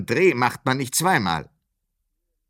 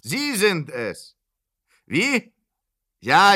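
ordinary talk

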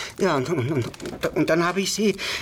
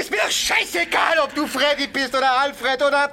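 angry shouting; 8 semitones higher than usual. Acted. Heated quarrel.

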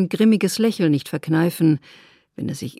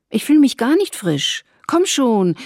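high, louder, breezy hurry-along; lively urging; 5 semitones higher, 6 dB louder than usual. A narrator's reading. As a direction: push him along, higher and louder. Isabel urging William at station.

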